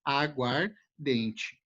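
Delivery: "Aguardente" drawn out very slowly; slow demo. Shown as a demonstration. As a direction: stretch every syllable of "Aguardente". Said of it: "tu vai perder ou o som desse A".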